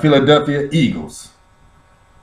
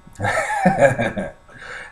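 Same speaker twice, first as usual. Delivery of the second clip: quiet laugh; 7 dB quieter than usual. Real, not acted. Laughs again after apology.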